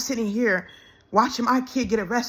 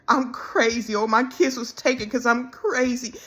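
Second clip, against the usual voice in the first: ordinary talk, nothing marked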